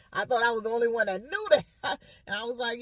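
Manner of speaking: ordinary talk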